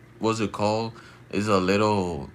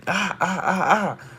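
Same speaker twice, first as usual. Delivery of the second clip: high mock yelps; imitated shouting; 10 semitones higher than usual. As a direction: mimic a crazy man's repeated cries. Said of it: "He's shouting like".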